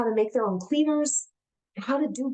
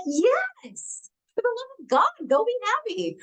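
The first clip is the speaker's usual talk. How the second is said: high-pitched, pleading exasperation; mock exasperation, pitched way up; exasperated reply; 10 semitones higher, slower than usual. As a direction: imitate an exasperated husband giving the go-ahead. Acting husband's exasperated reply to her.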